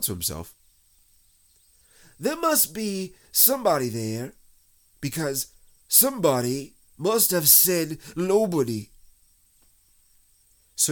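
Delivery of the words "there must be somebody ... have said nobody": high, deliberate bear voice; emphatic bear logic; Pooh, puzzling bear. A narrator's reading. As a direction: reason it out aloud. Narrator voices Pooh thinking.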